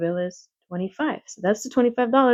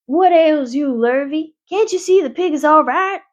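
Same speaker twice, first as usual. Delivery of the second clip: scolding; 8 semitones higher, 5 dB louder than usual. A narrator's reading. Zuckerman scolding Lurvy.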